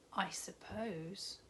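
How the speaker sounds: slow, whispered, unconvinced; half-give in under your breath; doubtful whisper. Acted. Unconvinced girl, whispered reply.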